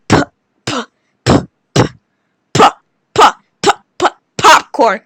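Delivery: "p" as a short puffed p; p sound. Shown as a demonstration. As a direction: pop the lips on "p". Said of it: "it just makes one sound".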